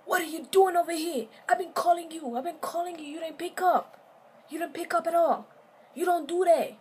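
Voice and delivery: high, loud furious yelling; angry yelling boyfriend. Retold or imitated. Boyfriend shouting at his girlfriend in the car, furious. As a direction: rage at her for not answering.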